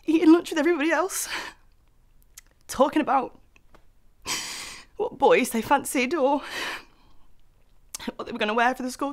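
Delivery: high, loud, tearful outpouring broken by gasps; overcome with emotion. Acted. Grieving child remembers lunchtimes and laughing with late mum, tearfully overwhelmed. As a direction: struggle to get the words out through sobbing breaths. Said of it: "way more intense than I thought it was going to".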